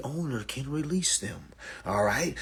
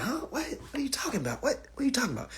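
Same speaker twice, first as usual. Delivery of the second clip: baffled; 9 semitones higher, 6 dB quieter, faster than usual. Retold or imitated. Acts out his own baffled reaction.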